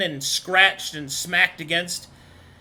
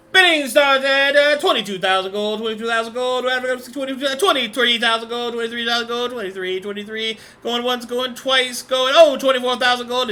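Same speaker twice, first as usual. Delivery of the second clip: louder, higher auction calling; auctioneer; 4 semitones higher, 4 dB louder than usual. Acted. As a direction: rattle off the bids like an auctioneer. GM voices auctioneer calling bids.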